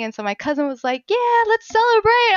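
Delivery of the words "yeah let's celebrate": high, loud whoop of a cheer; rally the table to party; imitated celebratory cheer. Cousin cheering her good news, jubilant.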